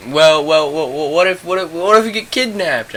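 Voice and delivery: high, stammering mock worry; mock worried friend. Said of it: "she was like".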